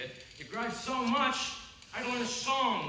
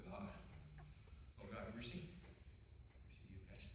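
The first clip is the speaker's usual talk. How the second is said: soft comforting; 5 semitones lower, 10 dB quieter, faster than usual. Acted. Michael comforts his hurt brother.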